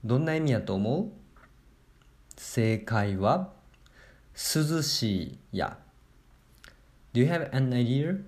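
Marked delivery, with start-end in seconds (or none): 2.4-5.8 slow answer reveal. Acted.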